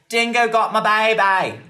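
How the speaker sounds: loud, slow Australian cry; Australian accent. Acted. Host shows off, in a hammed-up Australian accent. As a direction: belt it in a hammy Australian accent. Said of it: "That's my Australian accent".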